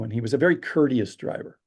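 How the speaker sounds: ordinary delivery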